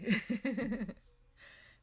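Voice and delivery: loud, high burst of laughter; real cackling giggle. Real, not acted. Amused at being too slow.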